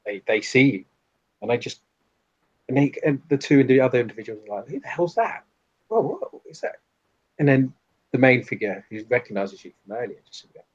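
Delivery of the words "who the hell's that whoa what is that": high-pitched, alarmed surprise; startled onlookers. Acted. Onlookers react to a sudden intruder, startled. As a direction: voice someone taken aback by an intruder.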